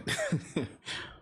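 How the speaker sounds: sudden high nervous giggle; nervous laugh. Real, not acted. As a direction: let a nervous giggle slip out. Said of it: "a nervous laugh, that's what that was".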